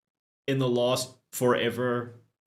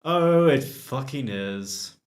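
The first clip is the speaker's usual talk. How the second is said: normal talking voice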